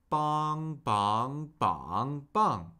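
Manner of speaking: cycle "bong" through flat, up, down-up, down; "bong" four times, each with the next Mandarin tone; four-tone drill. Shown as a demonstration. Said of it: "cycle this syllable through the four tones".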